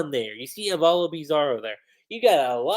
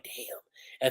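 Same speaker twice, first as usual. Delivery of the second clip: breathless whispered damn; 6 semitones lower, 9 dB quieter than usual. Retold or imitated. Fan quoting an awed soldier in a whisper. Kobe's stunned reaction, quoted.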